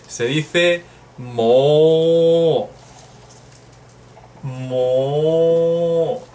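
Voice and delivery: drawn-out mo, said very slowly; slow model word. Shown as a demonstration. Teacher modelling mo slowly for students. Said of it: "¿Cómo se dice ya en japonés?".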